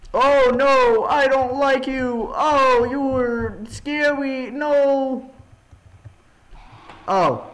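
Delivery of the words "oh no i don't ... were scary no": high, drawn-out whimpering protest; mock-scared wail. Frightened by the game monster.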